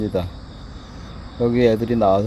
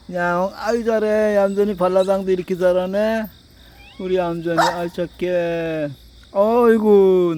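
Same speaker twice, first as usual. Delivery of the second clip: higher, cooing to a cat; cooing pet talk; 6 semitones higher, 3 dB louder than usual. Real, not acted. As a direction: coo over the cat fondly. Cooing at a cat rolling over.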